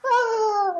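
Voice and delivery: an extremely high, thin squeak of a yell; God hearing a tiny man's yell from heaven, a squeaky high cry; tiny distant yell. Retold or imitated. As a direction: squeak it like a tiny figure far below. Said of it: "this little tiny guy".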